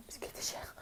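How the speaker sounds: very soft whispered aside; whispering. Real, not acted. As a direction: breathe it out unvoiced. In bed at night.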